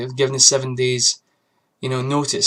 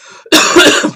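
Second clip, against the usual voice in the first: sudden hard loud cough; a harsh, unvoiced coughing fit; a real coughing fit mid-sentence; 13 semitones higher, 11 dB louder than usual. Real, not acted. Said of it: "pardon me, I get a terrible cough".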